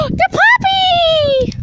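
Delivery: high, slow, excited squeal. Real, not acted. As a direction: squeal over a cute puppy. Spots a puppy.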